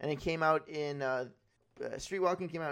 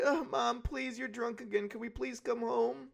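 high, pleading daughter's voice; higher-pitched, put-on weepy plea; mock crying; 7 semitones higher than usual. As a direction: imitate a tearful girl begging her mom. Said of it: "she starts crying".

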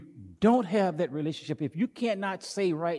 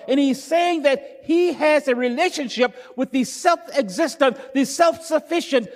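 high, loud, rising preacher's cadence; fervent pulpit delivery, climbing higher; preaching at full force; 8 semitones higher, 7 dB louder than usual. Acted. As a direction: preach it out, building to the peak.

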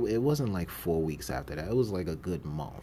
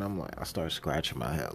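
ordinary talk, nothing marked

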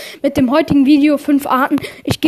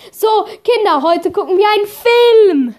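teacher voice; 6 semitones higher, 6 dB louder than usual. Acted. Comic teacher role announcing film.

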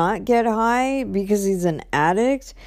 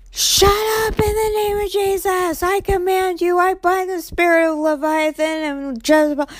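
preacher-style command, pitched way up; fierce spiritual rebuke; 11 semitones higher than usual. Retold or imitated. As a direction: cast out the spirit with full force. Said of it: "going off".

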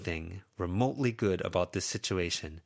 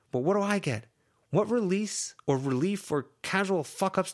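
put-on higher, raised, exasperated young woman; Lucy venting; 12 semitones higher, 5 dB louder than usual. Lucy venting at her friends, higher exasperated voice. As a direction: let it pour out, pleading and fed up. Lucy's outburst.

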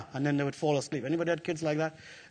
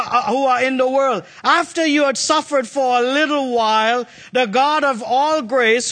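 preaching at full force; 5 semitones higher, 4 dB louder than usual. Acted. Preacher proclaiming the promise to the church, fervent. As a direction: declare it over the room.

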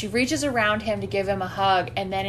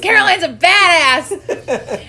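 loud, high, drawn-out cheer; slow, emphatic high-pitched praise; excited admiration; 7 semitones higher, 8 dB louder, slower than usual. Real, not acted. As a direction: crow it with real relish. Cheering the character.

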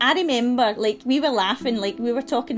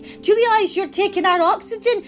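re-enacted protest, higher and louder; child's protest; 8 semitones higher, 4 dB louder than usual. Ten-year-old protesting to mum about cutting trees, higher-pitched indignant protest. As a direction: protest at them, pitched up and insistent. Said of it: "a protest in the house".

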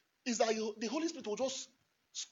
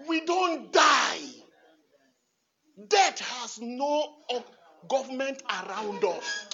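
loud, slow and high; shouted declaration; 3 semitones higher, 6 dB louder, slower than usual. Acted. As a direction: declare victory over death.

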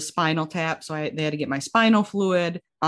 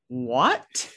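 stunned what; 7 semitones higher than usual. Retold or imitated. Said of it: "And I was like".

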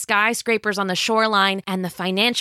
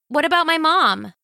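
put-on higher, fast and eager girl; eager teenage girl; 9 semitones higher, 3 dB louder than usual. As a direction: gush like a thrilled teen. Jill suddenly worried.

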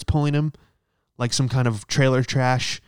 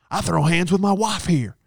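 mock testimonial; 7 semitones higher than usual. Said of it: "in charge of the commercials".

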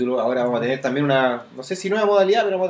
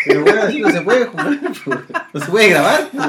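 laughing; 5 semitones higher, 5 dB louder than usual. Real, not acted. Laughing while trying to record.

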